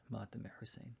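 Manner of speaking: a low whisper; hushed whisper to the mourners. Real, not acted. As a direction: breathe the words almost silently. Closing whispered line.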